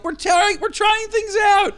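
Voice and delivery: mock defensive. Host replaying his answer to worried partner, mock defensive. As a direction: squeal a hammed-up excuse. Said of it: "I'm like".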